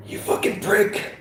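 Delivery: winded and angry; wheezing heavily. Acted. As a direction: force it out between wheezes. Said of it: "Seth, wheezing heavily".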